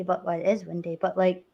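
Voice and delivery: normal talking voice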